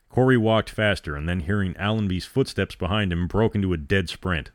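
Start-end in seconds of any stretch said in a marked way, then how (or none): none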